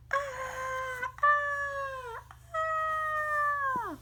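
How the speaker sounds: high, wailing cat cry; cat yowl. Acted. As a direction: wail up high like a beaten cat. Cat yowls in defeat.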